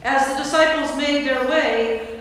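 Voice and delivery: ordinary talk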